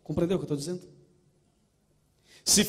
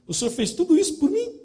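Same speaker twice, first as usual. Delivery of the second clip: much higher, astonished rising plea; amazed question; 11 semitones higher than usual. Acted. Believer asks Jesus in wonder, much higher. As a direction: ask it in wonder, voice soaring.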